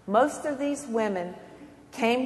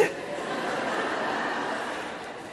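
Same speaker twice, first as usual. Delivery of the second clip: soft laugh; 7 dB quieter than usual. Real, not acted. Laughing after the anecdote.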